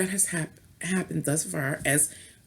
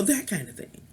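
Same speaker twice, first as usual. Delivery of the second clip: normal talking voice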